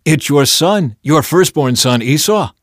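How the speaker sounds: urgent self-identification, loud and high; insistent reply. A narrator's reading. Son insists who he is.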